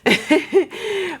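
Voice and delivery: high giggle after a brag; high laugh at herself. Real, not acted. Vlogger laughing at her own bragging, high giggle.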